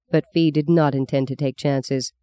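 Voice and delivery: ordinary delivery, nothing marked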